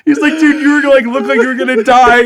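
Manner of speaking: high, loud, words squeezed through laughter; laughing hard. Real, not acted. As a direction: barely get the words out for laughing. Can't stop laughing retelling it.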